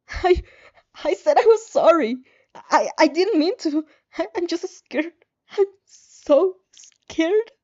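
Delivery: high, broken, tearful stammer; stammering apology, slowing to a whimper; scared crying plea. Acted. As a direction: beg through sobs, terrified. Terrified victim begs through tears.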